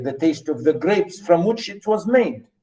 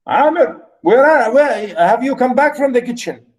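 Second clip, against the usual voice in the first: calling out; 8 semitones higher, 3 dB louder than usual. Real, not acted. Calling to a student.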